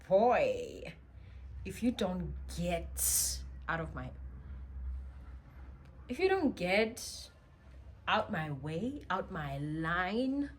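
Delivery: loud, sassy warning to back off; sassy attitude. Retold or imitated. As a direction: tell him off with full sass. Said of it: "I had attitude at the time".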